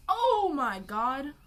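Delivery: loud, drawn-out delighted oh my god; excited gasp. Real, not acted. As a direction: gush over it loudly. Excited over matching plates.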